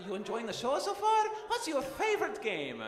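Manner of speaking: high-pitched character chatter; voice actor character. Acted. Voice actor chats with a fan on show floor, high character voice. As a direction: quiz a fan in a high cartoon voice. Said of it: "you're talking about the voice actor".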